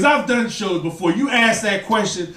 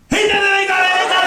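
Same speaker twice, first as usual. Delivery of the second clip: high, louder giddy chant; excited squeal; 10 semitones higher, 3 dB louder, faster than usual. Retold or imitated. The fiancee telling her friends, excited squeal. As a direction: gush it to your girlfriends. Girls celebrating engagement.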